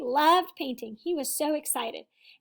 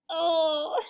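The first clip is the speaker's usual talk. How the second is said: ordinary delivery, nothing marked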